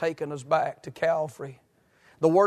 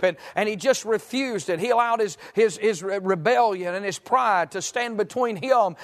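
higher-pitched, driving evangelistic plea; fervent preaching; 5 semitones higher than usual. Real, not acted. Preacher begging a lost friend to turn to Christ, fervent pleading. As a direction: plead urgently, pressing phrase after phrase. Preacher builds to urgent appeal.

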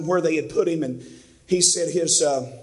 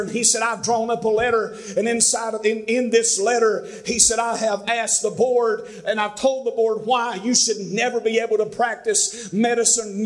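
higher, rising fervent preacher voice, stretching key words; preaching at full force; 5 semitones higher than usual. Acted. Pastor preaching to his congregation about one more chance, with full fervour. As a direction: preach it, climbing with every line.

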